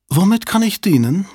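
prissy, lisping high voice; affected lisp. Acted. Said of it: "lispelte er affektiert".

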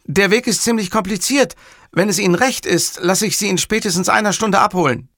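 high-pitched, businesslike subordinate; dutiful Fazio. Acted. Fazio offers the boss a pickup, high and businesslike.

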